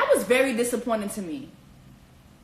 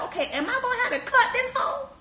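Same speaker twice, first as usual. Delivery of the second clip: jealous Amber; 12 semitones higher than usual. Retold or imitated. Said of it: "Amber was like".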